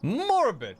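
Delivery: high, loud mock-shocked exclamation; amused exclamation. Real, not acted. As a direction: blurt it out, half laughing. Reacts to grim joke.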